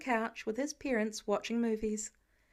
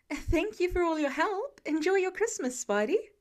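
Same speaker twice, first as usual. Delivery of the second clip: raised, higher friendly call; calling after; 6 semitones higher, 3 dB louder than usual. A narrator's reading. Staff member thanks Spidey as he leaves, calling out. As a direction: call your thanks after him as he goes. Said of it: "the lead staff member calls after him".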